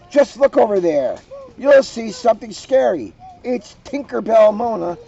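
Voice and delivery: high, loud jeering witch voice; mocking witch. Witch mocking Mona's costume, loud high jeering voice. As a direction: taunt the fairy girl, loud and high.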